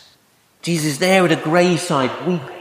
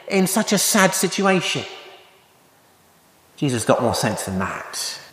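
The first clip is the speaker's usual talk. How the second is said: normal talking voice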